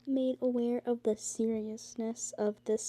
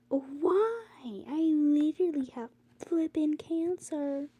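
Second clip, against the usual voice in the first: exasperated rant; 6 semitones higher, 4 dB louder, slower than usual. Real, not acted. Ranting about school board.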